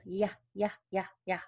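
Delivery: "Yeah" as short, separate "yuh" sounds. Shown as a demonstration. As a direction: repeat the isolated Y, "yuh", evenly spaced. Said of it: "back of the tongue goes up".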